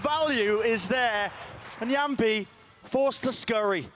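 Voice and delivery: high, slow excited commentary; esports caster. Real, not acted. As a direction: stretch each word with hype. Caster calls the aftermath.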